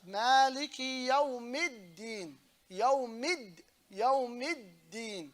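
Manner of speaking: very slow "Maliki Yawmiddin" with a long double d; stretched "Yawmiddin", break held on the d; held dal. Shown as a demonstration. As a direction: stop on the d of "Yawmid", hold, then release "din". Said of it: "on the dal".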